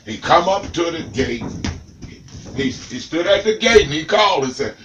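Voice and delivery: ordinary delivery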